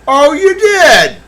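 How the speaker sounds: high, loud and slow sarcastic disbelief; mock indignant. Streamer mocking a viewer with fake outrage.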